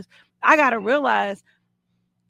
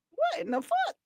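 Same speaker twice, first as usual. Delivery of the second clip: stunned disbelief; 7 dB quieter than usual. Retold or imitated. Stunned to see them hugging.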